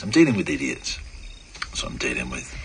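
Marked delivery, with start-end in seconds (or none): 1.7-2.5 muttering under his breath; annoyed mutter. Real, not acted. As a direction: grumble it to yourself, exasperated. Host grumbling at callers.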